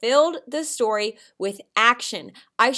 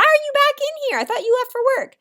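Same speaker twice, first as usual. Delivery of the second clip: high, louder puzzled question; surprised husband; 6 dB louder, faster than usual. Shown as a demonstration. Teacher replaying husband's surprised question. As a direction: ask like a startled husband. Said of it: "Mister Lanning asked me".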